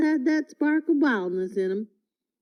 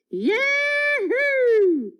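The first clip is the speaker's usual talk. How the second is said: shouted yahoo; 13 semitones higher than usual. A narrator's reading. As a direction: whoop it while riding the lion.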